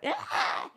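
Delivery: put-on a short high whispered wail like a crying kid; mock child wail. Telling about crying kids, a mock child wail. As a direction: wail like a little one in class.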